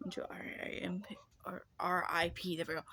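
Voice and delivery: whispered aside to herself; real whisper. Real, not acted. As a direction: breathe it out, no voice. Whispered aside after death.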